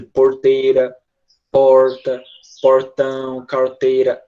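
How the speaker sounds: interior São Paulo caipira accent with the curled R in porteira. Shown as a demonstration. Teacher showing the São Paulo countryside R. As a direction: roll the curled caipira R through porteira, porta. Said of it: "aquele R de, entre aspas, caipira".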